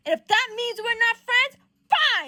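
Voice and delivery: high, loud outburst; angry ultimatum. Acted. Peter about to shove Johnny, loud angry ultimatum. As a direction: throw the last word down hard.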